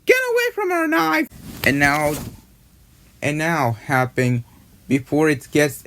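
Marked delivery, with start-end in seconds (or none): 0.0-1.3 very high-pitched, angry squeal; angry marshmallow. Acted. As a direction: squeal it in high, fast outrage. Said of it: "gets me angry and she says".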